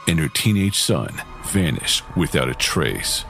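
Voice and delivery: usual speaking voice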